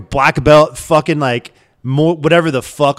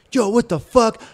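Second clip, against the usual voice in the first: a hint of the guard's higher, aggressive yell; mock angry yell; 8 semitones higher, slower than usual. Guard running up on him, mildly mocked angry yelling. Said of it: "just yelling in my face".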